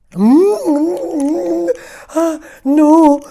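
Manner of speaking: high, loud yuck; disgusted melon. Acted. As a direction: spit out the taste. Melon disgusted by own taste.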